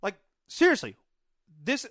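loud, high, exasperated husband; argumentative yelling. Retold or imitated. Husband arguing with his calm wife, argumentative yelling. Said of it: "with the yelling".

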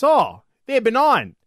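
doctor voice. Acted. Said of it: "And the doctor says".

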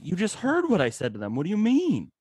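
mock fed-up reply; exasperated. Dad retelling his reply to his son, exasperated.

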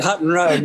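normal talking voice

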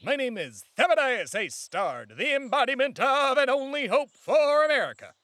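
pompous lobbyist proclaiming his name; grandiose self-introduction. Acted. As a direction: announce yourself like a savior. Character introduces himself.

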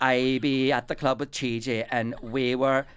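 slow, loud hammed-up Welsh; comic Welsh lilt, drawn out; Welsh accent. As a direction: imitate a Welsh reality show party-goer. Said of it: "it takes place in Wales".

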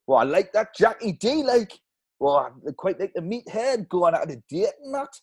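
a light Newcastle local's gruff opinion; Geordie passer-by. Acted. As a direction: Geordie man slagging off the podcast. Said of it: "I'll hand it over to them".

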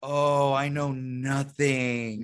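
slow, drawn-out comic lament; mock shame. Comic retelling his reaction to his wife's clip, mock ashamed. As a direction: drag out each word in exaggerated shame. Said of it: "I feel so ashamed".

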